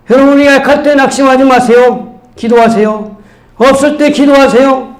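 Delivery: high, projected preaching; forceful pulpit proclamation. Real, not acted. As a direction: exhort the congregation with rising conviction.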